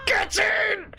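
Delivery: high-pitched victory yell; real triumphant yell; triumphant cheer. Real, not acted. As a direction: shout it high in celebration. Celebrates a success in game.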